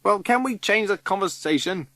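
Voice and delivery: 1 yell. Acted. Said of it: "he yells".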